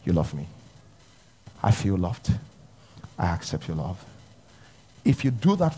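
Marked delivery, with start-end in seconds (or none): 0.0-4.0 soft surrender prayer. Pretend. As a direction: murmur it like a humble confession. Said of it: "and say".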